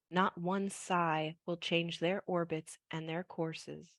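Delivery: soft, slow poetry recital; poem reading. Acted. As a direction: read it gently and slowly. Reads her poem aloud.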